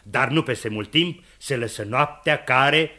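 ordinary talk, nothing marked

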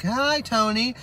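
high, breathy-free robotic coo; fembot wives. Retold or imitated. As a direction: greet him like a programmed fembot. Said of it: "in unison".

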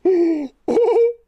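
a high, loud burst of laughter. Real, not acted. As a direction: crack up loudly. Laughing hard at a game moment.

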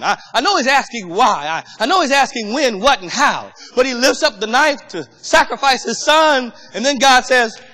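loud, fervent preaching build. Acted. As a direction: preach it with rising fire. Preaching at full force.

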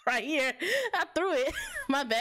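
words broken by laughter; sheepish laugh. Real, not acted. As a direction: giggle through it. Laughing at thrown bag.

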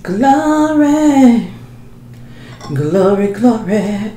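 drawn-out praise, high; praising glory. Real, not acted. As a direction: sing out glory in delight. Praising the food.

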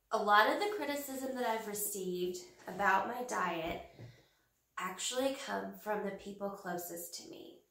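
usual speaking voice

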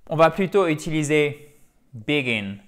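normal talking voice

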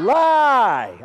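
put-on a sample shout of "Lie" for the kids to copy; mild demo yell. Shown as a demonstration. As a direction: show them how to yell it. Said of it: "I want you to yell out".

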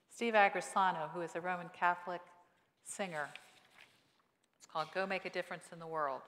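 normal talking voice

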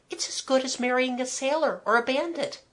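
put-on high girlish voice, delighted; Kathleen, delighted girl. A narrator's reading. Girl approving of the romantic match, delighted. Girl's dialogue.

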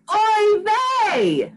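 put-on a mother wailing for her lost child; cried out. Mother fish crying out for her missing child, high wail. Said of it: "Mama Gefilte cried out".

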